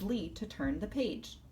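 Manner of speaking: ordinary delivery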